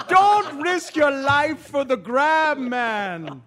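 put-on slow, high Jamaican accent; laughing through a Jamaican accent impression. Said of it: "it was in a Jamaican accent".

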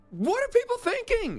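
very high exasperated question; incredulous. Real, not acted. Host to co-host about donors funding a rich star, incredulous. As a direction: send the pitch soaring in disbelief.

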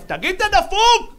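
very high, shrill-pitched mock outrage; mock screaming friends. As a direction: scream at him like he's insane. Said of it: "חברים שלו צרחו עליו". (חברים צורחים על הערבי בצעקה גבוהה)

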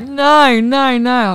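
high, loud pleading no; loud protest. Retold or imitated. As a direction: insist loudly, cutting her off. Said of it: "He's like".

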